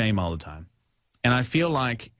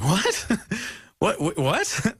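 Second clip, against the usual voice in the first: retold disbelief; 7 semitones higher, slower than usual. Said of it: "his own reaction retold".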